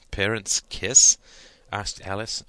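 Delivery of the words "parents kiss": loud, drawn-out mock disbelief; half-kidding shock, slow and loud; half joking. A narrator's reading. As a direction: stretch it out as an incredulous question. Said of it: "only half joking".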